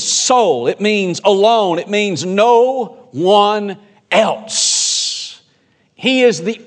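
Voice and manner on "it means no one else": no one else stretched out very slowly; slow emphasis. Shown as a demonstration. Pastor defining only, very slow and weighty. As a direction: spell out no one else word by word. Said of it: "The emphasis here in this verse is the word only".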